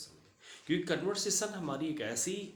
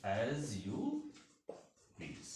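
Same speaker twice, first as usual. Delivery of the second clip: as you please, split into slow parts with a pause before please; slow model phrase; 4 dB quieter, much slower than usual. Shown as a demonstration. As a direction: spell out "as you please" word by word, fading on please. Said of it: "English में हम उसको बोल सकते हैं as you please". ("as you please" धीरे-धीरे, रुक-रुक कर)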